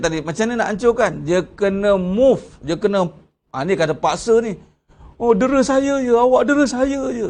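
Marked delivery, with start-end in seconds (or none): 5.1-7.3 high-pitched mock whining protest; mock complaint. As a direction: imitate a patient protesting mistreatment, pitched up. Acts out a patient's complaint. (meniru rungutan orang terdera, nada tinggi)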